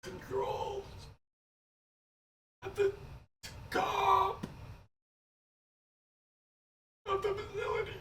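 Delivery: high, airy corpse voice drawn in on the breath; talking while inhaling. Acted. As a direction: speak on the in-breath like a dead thing. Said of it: "how to speak while inhaling".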